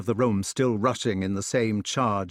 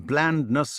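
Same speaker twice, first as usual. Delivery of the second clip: plain reading voice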